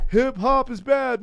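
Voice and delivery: high, loud, drawn-out scolding imitation; mock disapproving moralist. Conservatives condemning rap CDs, mock scolding voice. Mocking conservatives of his childhood.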